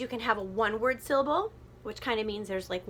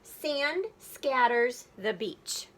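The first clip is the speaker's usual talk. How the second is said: each word laid out slowly; measured classroom reading; slow poem reading; 2 semitones higher, much slower than usual. Shown as a demonstration. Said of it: "let's take a look at the lines".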